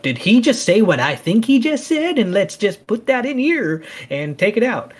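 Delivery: high-pitched trolling put-on voice; internet troll accent. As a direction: sneer it out like a smug internet troll. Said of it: "Sorry, that was my weird, I don't know what my accent was there".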